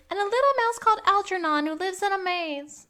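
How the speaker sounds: high-pitched, hammed-up outburst; mock psychic freak-out. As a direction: blurt it high like a sudden vision. Continues acting out Sean's psychic freak-out.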